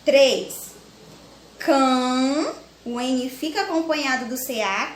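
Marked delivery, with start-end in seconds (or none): 1.6-2.5 "Cã" held long on its own; isolated syllable. Shown as a demonstration. Teacher starting a three-syllable example, isolated syllable. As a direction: stretch "Cã" as the first beat of canguru. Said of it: "Trissílaba".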